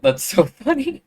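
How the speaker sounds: giggly, high and loud; laughing. Real, not acted. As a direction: laugh through the words. Laughing at own attempt.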